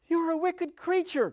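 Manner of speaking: put-on high, loud accusing girl; crying out. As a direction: hurl it at the witch. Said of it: "cried Dorothy".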